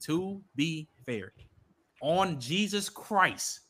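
drawn out and hushed; very slow, soft to settle the argument; slow, quiet point. Real, not acted. Slows to make point.

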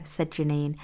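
ordinary delivery, nothing marked

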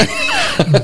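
a short laugh shooting way up high; high laugh burst. Real, not acted. Recalling bruising his seat on the ramp, a high squeaky laugh.

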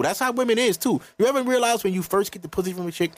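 normal talking voice

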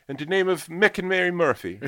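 high, loud Irish landlady voice; landlady's voice. Retold or imitated. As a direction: bark it out like a brusque old landlady.

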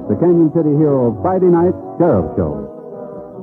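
usual speaking voice

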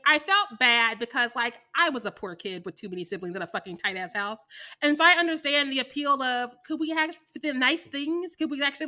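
ordinary talk

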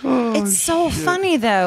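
leicht beschwipst, hoch und gedehnt; tipsy, drawn-out praise. Real, not acted. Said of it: "I'm a little tipsy".

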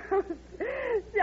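short high laugh of delight. Acted. Hostess laughs on seeing her friend arrive, delighted laugh.

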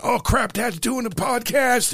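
mock alarmed teen squeal; quoting son. Dad imitating son hanging up on podcast, high mock panic. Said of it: "He went".

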